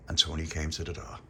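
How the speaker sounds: ordinary delivery, nothing marked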